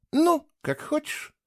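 slow, high, showy shrug of a reply; feigned indifference. A narrator's reading. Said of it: "с показным безразличием". (с показным безразличием, протяжно)

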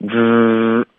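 之 sustained as a blurred, rounded "dr"; pouted DR. Shown as a demonstration. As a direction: curl the tongue, pout and hold 之. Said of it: "这是我们要的效果". (嘟嘴拖长的 之)

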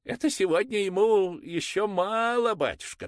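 high, loud, proud; proud mother. Acted. Mother proudly telling the priest, high and loud. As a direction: boast to the priest. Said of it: "гордо сказала мать".

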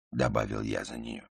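ordinary delivery, nothing marked

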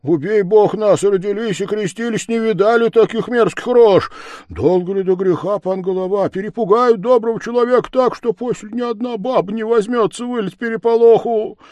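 high-pitched, agitated complaint; pleading frightened men. Acted. Men beg off the chase, high and agitated.

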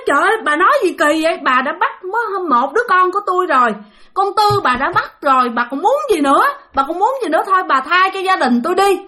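high, agitated protest turning to pleading; crying out. Acted. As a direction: protest as a desperate grandmother. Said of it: "Xong cái ngoại mới la".

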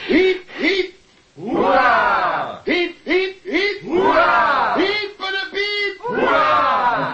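hip hip hooray shouted; cheering. Acted. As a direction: cheer her on like a crowd. Recorded cheer for the guest. (juichend hiep hiep hoera)

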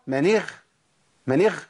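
من اغ- ending in a breathy kh; wrong ghayn. Shown as a demonstration. Teacher telling the student it won't do, deliberately mispronounced. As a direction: turn the gh of من اغ- into kh. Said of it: "ما ينفعش يا شيخ".

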